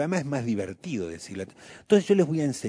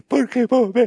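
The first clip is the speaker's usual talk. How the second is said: put-on high, loud whining kid; whiny child; 11 semitones higher, 9 dB louder than usual. Child voice whines at mom to make her anxious. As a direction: whine like a child guilting his mother.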